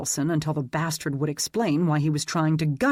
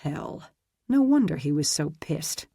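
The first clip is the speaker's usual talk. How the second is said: usual speaking voice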